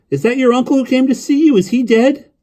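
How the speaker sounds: put-on high, loud child's voice; piping, insistent boy's question. A narrator's reading. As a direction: blurt it out as a young boy. Boy asking urgently about the death.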